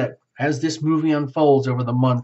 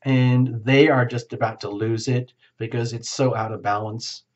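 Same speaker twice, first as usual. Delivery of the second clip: ordinary talk, nothing marked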